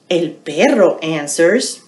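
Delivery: ordinary delivery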